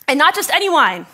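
ordinary delivery

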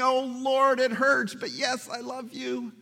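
high, aching, hurt plea; pained pleading. Retold or imitated. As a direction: plead as if it hurts. Peter pained, asked third time.

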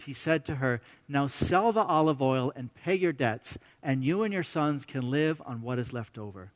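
soft, measured Bible reading; quiet scripture reading voice. A narrator's reading. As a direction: read the passage gently to the congregation.

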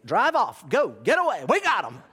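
high, loud shooing-away shout; urgent teacher. Retold or imitated. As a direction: imitate a teacher hurrying parents off. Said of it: "is there like".

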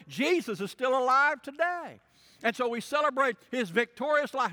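loud, rising gospel declaration; full-force preaching. Acted. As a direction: proclaim it to the whole room.

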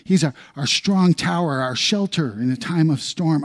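soaring sermon delivery; fervent preaching. Acted. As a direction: preach it out to the congregation. Preaching at full force.

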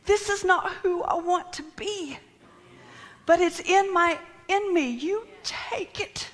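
high-pitched plea of surrender; anguished pleading. Acted. Person handing their burden to God, anguished pleading. Acts out desperate prayer.

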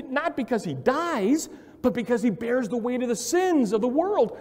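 high, loud preaching build; fervent sermon climax; preaching at full force. Acted. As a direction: drive it home from the pulpit.

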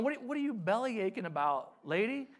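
mock-impatient scolding, pitched high; hammed-up dismissive telling-off; condescending. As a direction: talk down to her as if fed up. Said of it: "it's not a condescending question".

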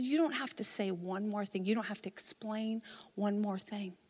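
low, hushed comfort; retold gentle pastoral consolation; tender reassurance.

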